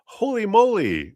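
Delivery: like you just saw a miracle; amazed. Retold or imitated. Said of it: "and then we're like".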